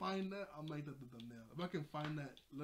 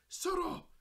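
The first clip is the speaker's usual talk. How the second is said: high, loud mock outburst; retold angry shout, voice jumping up; angry yell; 9 dB louder than usual. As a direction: yell it to silence the crowd. Mayor silencing the townspeople.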